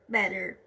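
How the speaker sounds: better repetido com T soando R, mais grave; better com T batido, tom baixo; American better again. Shown as a demonstration. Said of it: "o T tem um som de R". (better à americana)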